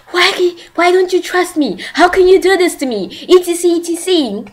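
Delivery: high-pitched, mock-hurt protest; hurt accusation. He confronts her over the plane ticket, high mock-hurt accusation. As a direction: retell it as a wounded, pleading complaint. Retelling an upset man's accusation.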